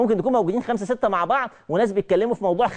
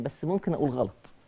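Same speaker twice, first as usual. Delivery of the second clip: timid hesitation; 4 semitones lower, 8 dB quieter than usual. Retold or imitated. Voicing a hesitant, fearful person.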